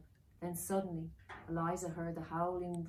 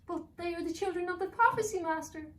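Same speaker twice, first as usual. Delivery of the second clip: very high, louder elf pleading; 12 semitones higher, 7 dB louder than usual. Acted. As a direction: servant begging the master.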